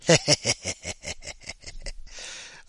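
airy burst of laughter; breathy laugh. Real, not acted. Laughing at the lobster punchline.

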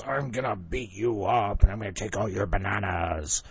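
a hint of a gruff bully; lightly voiced thug gorilla. Big gorilla threatens the smaller one, lightly gruff. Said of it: "the nine hundred pound gorilla goes".

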